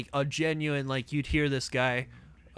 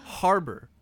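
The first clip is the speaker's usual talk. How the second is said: ordinary talk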